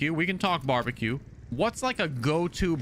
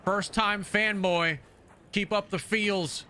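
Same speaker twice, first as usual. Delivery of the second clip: reading the super chat slowly and deliberately; slow chat readout; 4 semitones higher, slower than usual. Retold or imitated. Streamer reads a fan's super chat aloud slowly. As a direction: drawl out each line of the message. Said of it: "the twenty dollar super chat".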